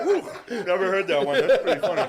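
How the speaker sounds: cop quoted through high laughter; laughing cop. Retold or imitated. Truck driver's story, cop cracking up at the joke, laughing. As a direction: laugh the lines out as the amused cop. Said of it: "He's like".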